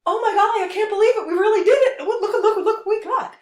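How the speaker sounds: high, loud, giddy mock gloat with a laugh; gloating Musk impression. Musk in a cat burglar outfit, crowing in high mock glee. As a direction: ham up a thief crowing over the loot. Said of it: "almost going".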